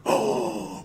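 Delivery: sharp stunned gasp; shocked gasp. Acted. Courtroom shocked by accusation.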